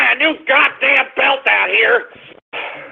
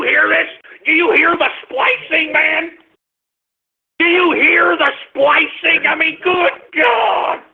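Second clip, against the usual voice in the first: outraged disbelief; 7 semitones higher than usual. Real, not acted. Host demanding listeners hear the splicing, outraged. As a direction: make them hear how absurd it is.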